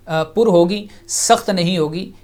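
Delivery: normal talking voice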